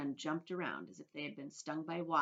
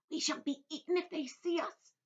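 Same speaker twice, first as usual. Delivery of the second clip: earthworm voice; 12 semitones higher, 4 dB louder than usual. A narrator's reading. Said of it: "the earthworm whispered".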